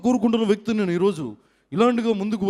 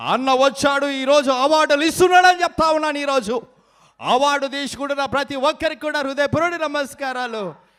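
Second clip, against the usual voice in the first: louder, higher, fast announcing; loud leader; 6 semitones higher, 4 dB louder than usual. Acted. Mimic announces the awards as a louder, higher leader. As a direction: announce the leader has come.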